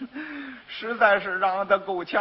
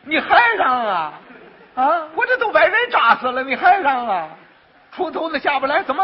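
exasperated scolding; 5 semitones higher, 4 dB louder than usual. Acted. Master scolds starving apprentice.

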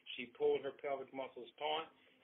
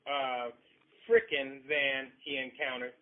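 angry retort; 3 semitones higher, 8 dB louder than usual. Acted. Husband answering his wife, a loud angry retort. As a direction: bite back hard.

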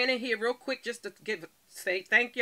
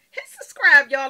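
much higher and louder shout-out; loud call; 5 semitones higher, 13 dB louder than usual. Real, not acted. Urges her viewers to subscribe, loud excited call. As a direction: call it out big.

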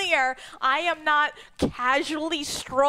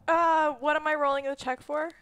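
ordinary delivery

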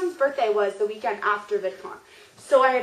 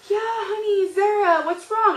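high, cooing concern, imitated; imitating a doting, worried idol; concerned sweetness; 8 semitones higher than usual. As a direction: coo it like comforting an upset child. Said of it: "And he's like".

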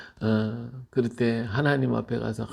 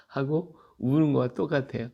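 ordinary delivery

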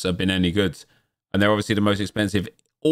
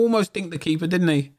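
excited; 9 semitones higher than usual. Real, not acted. Replaying near chip.

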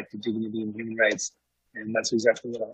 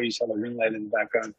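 normal talking voice

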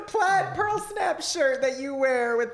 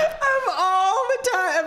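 laughing through the words, slowed down; can't get it out for laughing; 8 semitones higher, much slower than usual. Real, not acted. Wife laughing at the yard sign, laughing hard.

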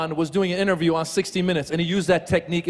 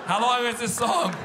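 mock complaint; 4 dB quieter than usual. Pained audience member complains.

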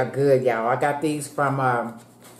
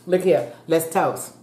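ordinary talk, nothing marked

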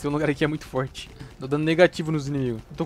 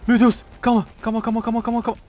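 panicked pleading; 7 semitones higher, 7 dB louder than usual. Real, not acted. Gamer panics under sudden attack.

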